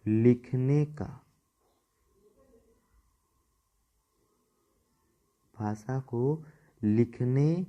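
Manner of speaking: slow, word by word, with long gaps; slow dictation. Shown as a demonstration. Teacher dictating the definition to students, slow and spaced. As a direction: dictate each word so students can write it down. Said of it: "note करते जाएंगे".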